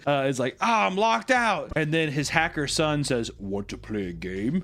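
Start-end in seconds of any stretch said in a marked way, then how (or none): 0.6-1.7 high, drawn-out mock wail; hammed-up cry of frustration; mock dismay. Acting out a locked-out character's reaction. 3.4-4.5 imitated hacker son. Imitating the hacker son's sly line.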